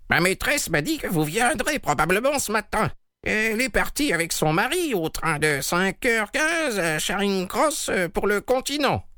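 put-on high-pitched old-woman character voice; elderly maidservant. A narrator's reading. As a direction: report it in a high, put-on servant's voice. Voices the elderly maid in story.

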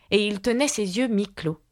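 plain reading voice

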